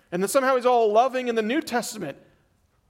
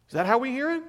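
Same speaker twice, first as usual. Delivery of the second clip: normal talking voice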